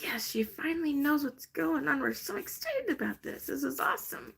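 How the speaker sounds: high, giddy voice for the spirits; excited spirits. Pretend. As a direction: play the spirits celebrating. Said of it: "They're like".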